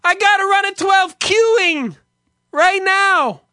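high, loud mock Spanish-accented shout; screamed back in broken English. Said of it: "he would scream back".

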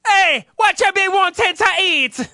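high, loud hammed-up Jamaican accent; mock Jamaican accent. Pirate waiter asking for an order in a mock Jamaican accent. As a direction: belt it like a cartoon Jamaican. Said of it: "Dude, Jamaican".

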